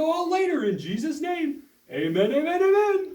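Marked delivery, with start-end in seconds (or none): 1.9-3.2 rising amens. Acted. Host ends rising amens.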